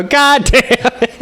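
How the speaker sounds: retelling shock at stepdad's Trump sign; exasperated outburst. Retold or imitated. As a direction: blurt it out in exasperation.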